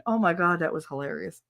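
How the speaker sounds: ordinary delivery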